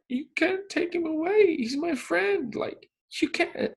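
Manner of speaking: put-on very high tearful kid's plea; high-pitched imitation of a sobbing boy; mock crying child. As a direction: plead like a child in tears. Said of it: "he just starts crying".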